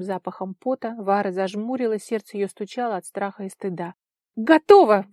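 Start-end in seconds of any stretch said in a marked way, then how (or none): 4.4-5.1 loud, urgent announcement; exclaimed out. A narrator's reading. Tigir finishes patching and tells her, loud exclamation. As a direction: burst out that it's finished. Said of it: "выдохнул лататель".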